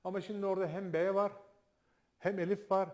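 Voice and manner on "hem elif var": usual speaking voice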